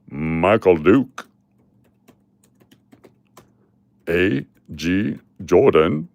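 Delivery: drawn-out drawl, low then swooping sharply up; hammed-up Southern roll call; Uncle Cliff. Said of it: "do my best Uncle Cliff voice".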